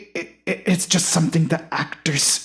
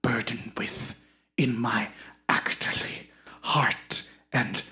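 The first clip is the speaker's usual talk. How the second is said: grandiose actor, fading to a whisper; 5 semitones lower, 7 dB quieter than usual. Acted. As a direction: introduce yourself with hushed theatrical self-importance.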